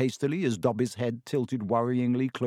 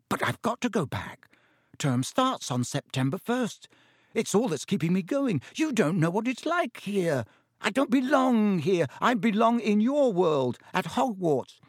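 put-on boy's plea, climbing higher; upset protest; 9 semitones higher than usual. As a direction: protest with mounting desperation. Harry protests having to stay away.

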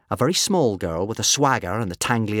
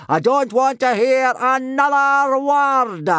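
slow, loud, blustering roar, pitched up; 12 semitones higher, 5 dB louder, slower than usual. A narrator's reading. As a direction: boom out each boast, building higher to the end. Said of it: "roared Gobber".

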